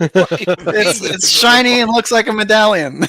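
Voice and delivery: very high, loud, laughing imitation; squeaky, exaggerated retold bickering; mock teammate explaining. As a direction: blurt an obvious answer in hammed-up exasperation. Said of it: "That's exactly what he said".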